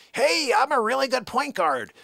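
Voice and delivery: high, cocky parody voice; mocking braggart. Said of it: "you were like".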